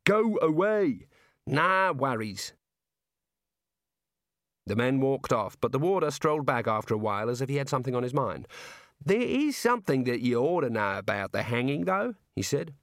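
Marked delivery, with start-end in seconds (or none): 0.1-1.1 high, stretched-out dismissal; exasperated call. Acted. Prisoner orders jailers out again. 1.5-2.5 slow, higher Australian jailer; breezy Aussie warder. Acted. 9.0-12.2 jovial Aussie warder. Acted. Warder returns with news.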